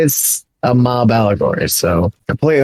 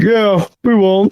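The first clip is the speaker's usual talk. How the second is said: high, drawn-out mock agreement holding back a laugh; stifling laughter; 8 semitones higher, slower than usual. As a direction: agree as if trying not to crack up. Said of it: "try not to laugh out loud".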